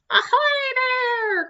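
high, drawn-out call from above. Acted. Pirate calling down to his captain, high and drawn out. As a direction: hail them from far up, slowly. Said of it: "called a voice".

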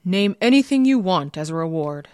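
loud royal proclamation; put-on imperial voice, loud; grand emperor. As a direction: announce it grandly as a ruler.

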